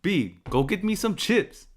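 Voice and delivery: slow, high, put-on New York voice; playful fake New York order; mock New York accent. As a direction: drawl it out New York style.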